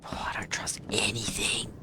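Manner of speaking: hushed, breathy whisper. Real, not acted. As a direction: whisper it under your breath. Creeping through spooky house.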